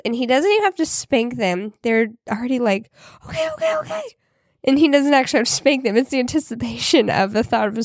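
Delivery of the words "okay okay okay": high squeaky pleading okays; mock-panicked child begging; panicked kids. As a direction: plead like a scared kid about to be spanked. Kids dreading a spanking.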